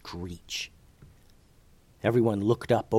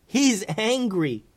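high, slow, urgent warning; a bit of panic, pitched high; mildly panicking; 12 semitones higher, slower than usual. A narrator's reading. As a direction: warn them anxiously in a raised pitch. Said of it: "looking over his shoulder and panicking a bit".

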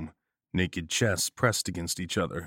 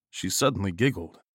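ordinary delivery, nothing marked

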